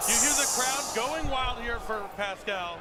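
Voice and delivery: slow, loud hype call; excited shout. Real, not acted. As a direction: sell the crowd's roar. Crowd going wild, caster hyped.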